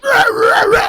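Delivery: loud, high mock zombie roar; zombie growl. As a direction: snarl like a zombie about to bite. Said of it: "She coulda just been like".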